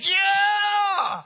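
very high, loud, drawn-out cheer; a hammed-up fan's whoop; mock victory yell. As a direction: cheer like a dad dancing in the stands. Dad doing victory dance in stands.

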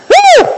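very high "woo!"; excited whoop. Real, not acted. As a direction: let out a revival-meeting whoop. Preacher whoops after hallelujah.

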